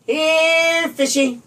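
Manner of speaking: high sing-song call to a fish; coaxing high call. A narrator's reading. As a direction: call the fish sweetly from the bank. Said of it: "she called".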